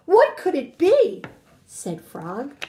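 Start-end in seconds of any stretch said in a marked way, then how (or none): 0.1-1.2 animated, emphatic storybook reading; story read-aloud to children. Acted. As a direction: punch key words, lingering on descriptive ones.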